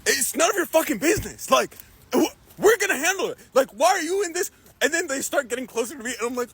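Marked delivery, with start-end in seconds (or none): 0.1-4.5 high, indignant imitation; retold anger. The fighters turn on the storyteller, in high mock anger. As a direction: act out telling off a meddler, pitched up. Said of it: "starting to get angry with me".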